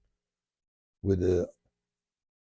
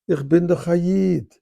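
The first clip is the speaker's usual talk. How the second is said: higher-pitched Polish Yiddish; Polish Yiddish accent; 7 semitones higher, 4 dB louder than usual. Retold or imitated. Guard revealing himself to the rabbi, Polish Yiddish accent. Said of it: "Polish Yiddish accent".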